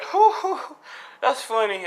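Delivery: high, loud mock hooting laugh; acted laughing. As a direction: hoot with put-on amusement. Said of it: "They're just laughing".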